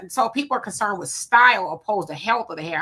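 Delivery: usual speaking voice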